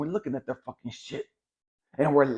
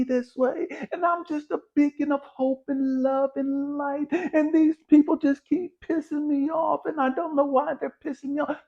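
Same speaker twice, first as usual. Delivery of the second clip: much higher, sing-song fake innocent voice; mock sweet victim; 14 semitones higher, 4 dB louder than usual. Mocking the spiritual crowd, much higher and sugary. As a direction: parody a syrupy love-and-light type.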